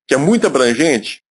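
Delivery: normal talking voice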